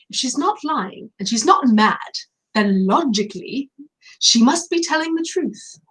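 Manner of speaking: a playful attempt at a British accent. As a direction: quote the Narnia professor in an amateur British accent. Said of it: "I wanna say it in my best British accent".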